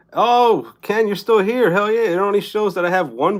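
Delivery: high, loud happy surprise; delighted to see a viewer still there; excited surprise. Real, not acted.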